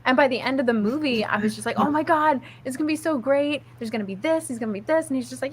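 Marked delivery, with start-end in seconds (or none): 1.8-5.1 higher-pitched fangirl gushing; retold gushing excitement. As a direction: gush about what is coming, imitating yourself. Retold gushing after the film.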